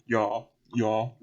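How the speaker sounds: a slip into Southern drawl; Southern accent. Real, not acted. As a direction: let the Southern accent creep in. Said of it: "that's my southern accent coming in".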